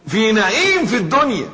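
high, loud, drawn-out "نعيم"; emphatic insistence. Real, not acted. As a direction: stretch "نعيم" high and hammer "في الدنيا". Drives home the corrected reading.

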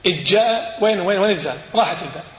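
normal talking voice

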